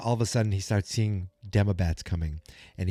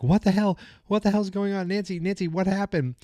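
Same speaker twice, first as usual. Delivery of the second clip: high, alarmed cries; panicked shout; 10 semitones higher than usual. Retold or imitated. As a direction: panic as he vanishes, pitched up. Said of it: "they're like".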